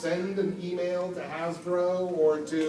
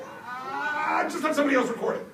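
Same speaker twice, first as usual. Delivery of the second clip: imitating producers; 12 semitones higher, 5 dB louder than usual. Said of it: "It was like".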